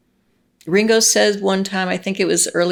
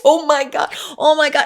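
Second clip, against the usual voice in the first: high, louder awestruck "oh my God"; awed gasping; 8 semitones higher, 3 dB louder than usual. Retold or imitated. Drunk fan to friend watching the Beatles on TV, high awestruck gushing. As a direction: gush it like you can't believe your eyes. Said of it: "kinda going like this".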